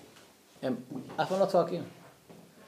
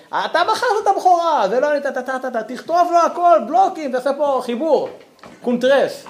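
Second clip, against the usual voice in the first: high, loud ranting accusation; hammed-up fury, pitched high and loud; mock angry tirade; 9 semitones higher, 6 dB louder than usual. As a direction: vent at him like a wronged brother. Venting rage at Esau in letter.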